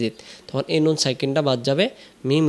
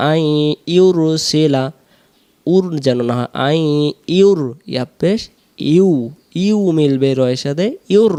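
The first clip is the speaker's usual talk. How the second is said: normal talking voice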